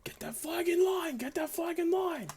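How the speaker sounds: high, slow shouted command; barked order. Acted. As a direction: call it across the line, drawn out. Officer ordering flag into line.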